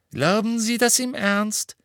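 high-pitched, contemptuous question; scornful. A narrator's reading. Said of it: "fragte Professor McGonagall verächtlich".

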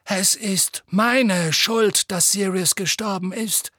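slow, clear confession; a clear, unflinching admission; clearly. Acted. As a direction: say it plainly, owning it. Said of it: "sagte Dumbledore klar".

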